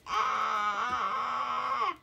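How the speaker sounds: short loud yelp; mock scream. Reader pretending fright at costumes, loud mock yelp. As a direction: yelp as if startled.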